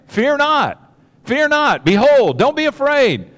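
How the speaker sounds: high, loud proclamation; heavenly announcement. Retold or imitated. Angel proclaiming to frightened people on earth, loud and high. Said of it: "the first thing that they start the conversation with".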